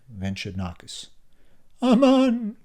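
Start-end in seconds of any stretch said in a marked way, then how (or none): 1.8-2.6 high shrill cry of alarm. A narrator's reading. Tula crying out in horror at the news. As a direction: cry out in shock with hands to heart. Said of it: "cried Tula".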